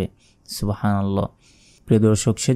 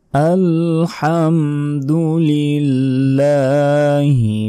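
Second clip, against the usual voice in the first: high, slow melodic tilawat; Quranic recitation; 5 semitones higher, 3 dB louder, much slower than usual. Shown as a demonstration. Teacher reciting the verse for learners, high drawn-out chant. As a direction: stretch each vowel in a sung recitation.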